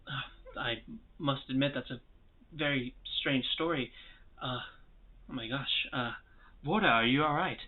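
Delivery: lightly voiced bewildered dwarf; puzzled dwarf. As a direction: mull it over quietly, taken aback. Game master voices puzzled dwarf.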